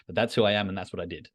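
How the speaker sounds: ordinary delivery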